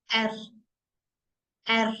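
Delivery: teacher demos एर, the German R, slowly; German R sound. Shown as a demonstration. Teacher shows students German R: एर said slowly and clearly. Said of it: "आर को हम pronounce करेंगे एर".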